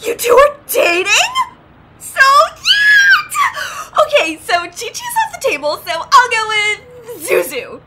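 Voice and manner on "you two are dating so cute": very high, loud fangirl squeal; squealing delight. Acted. Hearing the two are dating, a high loud squeal. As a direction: squeal at how cute they are.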